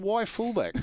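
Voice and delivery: high, disbelieving "Why fullback?"; incredulous protest. Retold or imitated. Player questioning the coach again, high incredulous. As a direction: push back in disbelief.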